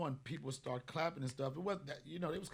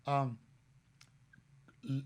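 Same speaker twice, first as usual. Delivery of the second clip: ordinary delivery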